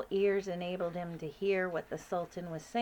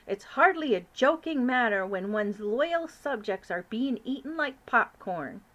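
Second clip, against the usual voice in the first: irritated, louder king; testy king; 4 semitones higher, 7 dB louder than usual. Acted. King of Sweden losing patience, testy. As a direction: get a bit annoyed. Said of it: "growing testy".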